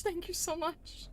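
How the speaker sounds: ordinary delivery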